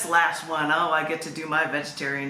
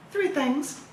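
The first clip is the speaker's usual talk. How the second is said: cheesy title read; 9 semitones higher than usual. Shown as a demonstration. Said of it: "I like to be a cheese ball with my book".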